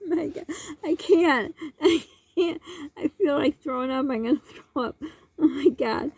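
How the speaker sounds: real laughing fit, words squeezed out; laughing until nearly sick, barely able to talk; helpless laughter. Real, not acted. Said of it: "I can't keep it together".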